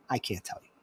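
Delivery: very soft, low, secretive reply; barely audible, guarding a secret; hushed refusal. Retold or imitated. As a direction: refuse under your breath. Retold refusal to share secret.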